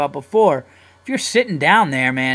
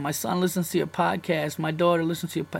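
imitating crying parent; 3 semitones higher, 3 dB quieter than usual. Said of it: "parents calling me up crying".

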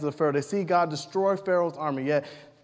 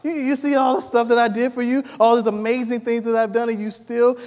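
imagined exasperated God; 5 semitones higher than usual. Retold or imitated. Preacher playing God scolding the grumbling Israelites, exasperated. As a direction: scold them as a fed-up God. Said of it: "I can only imagine if I was God".

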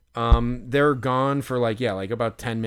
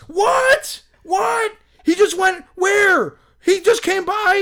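much higher, loud, crying-out shock; stunned disbelief; 6 dB louder, slower than usual. Retold or imitated. Fan told the player just left, shocked yelling. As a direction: yelp it like the news just hit you.

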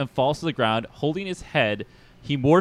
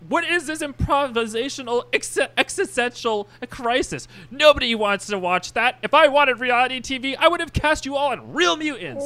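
high-pitched ranting showman; Mojo, tyrannical director, outraged; 12 semitones higher than usual. Acted. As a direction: rant in a high, indignant voice.

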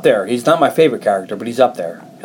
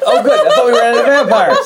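high-pitched, giddy retelling of her excitement; excited; 8 semitones higher, faster than usual. Retold or imitated. Co-host imitating a friend's reaction to more vampires, excited. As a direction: mimic her delight through laughter. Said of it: "Carly got excited".

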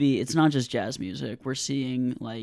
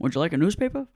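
a hint of a newsreel announcer, higher and louder; old newsreel voice; 6 semitones higher, 6 dB louder, faster than usual. Friend offers a newspaper in an old-timey newsreel voice. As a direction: offer the paper like a vintage radio host. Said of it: "That voice".

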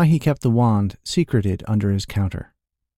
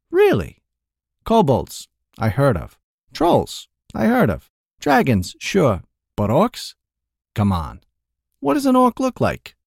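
mocking merchant; 3 semitones higher than usual. A narrator's reading. Merchant teasing the thief about being good, mocking higher voice. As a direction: ham up the doubt.

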